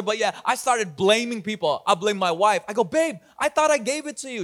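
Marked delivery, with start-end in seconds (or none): 2.8-4.4 high-pitched acted-out complaint; mock accusing. Pastor replaying blaming his wife, mock accusing. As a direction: imitate blaming a partner, pitch raised.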